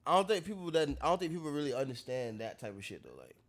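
ordinary talk, nothing marked

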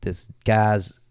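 slow, put-on Southern storytelling; playful Southern US accent. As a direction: stretch the words in a Southern drawl. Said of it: "tell the story in an accent".

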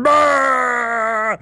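high, loud cartoonish bellow; mock villain yell. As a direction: bark it like a campy movie villain. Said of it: "comes out with a guy going".

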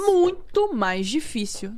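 slow, drawn-out stress on muito mais; emphatic agreement. Real, not acted. To her cohosts about internet moms, slow emphatic stress. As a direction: lean hard on each word, slowly. Agreeing that online motherhood is harder.

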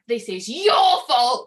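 loud, slow mock accusation; imitating angry guest. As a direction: hammer the blame on her. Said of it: "he was, like, shouting down at me".

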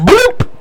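high comic bloop sound; cartoon bloop. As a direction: pop out a silly high bloop. Imitates her fall sound.

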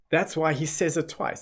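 ordinary talk

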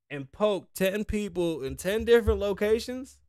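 ordinary delivery

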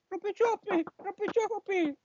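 high, slow squeaky ghost cry. Acted. Ghost noise in radio sketch. (voz de fantasma aguda)

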